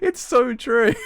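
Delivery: high, loud words through laughter; helpless laughing agreement; cracking up. Real, not acted. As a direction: squeal it out mid-laugh. Amid laughing fit.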